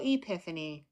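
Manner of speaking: ordinary delivery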